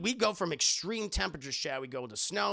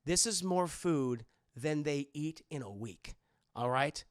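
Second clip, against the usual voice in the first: stern Indy; 2 semitones lower than usual. Retold or imitated. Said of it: "he's like".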